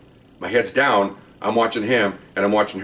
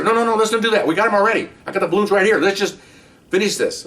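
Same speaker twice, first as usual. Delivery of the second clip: higher, insistent imitated boss; boss overruling; 10 semitones higher than usual. Supervisor refusing to take the suspect down, insistent higher. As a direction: shut the idea down firmly. Boss vetoing arrest plan.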